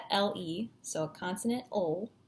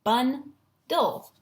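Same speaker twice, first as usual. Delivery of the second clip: BUNDLE as bun, pause, dul, pitched up; louder BUNDLE, syllable by syllable; split into syllables; 4 semitones higher, 5 dB louder than usual. Shown as a demonstration. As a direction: break BUNDLE into two firm chunks. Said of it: "these are the syllables".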